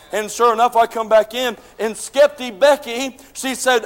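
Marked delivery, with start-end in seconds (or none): none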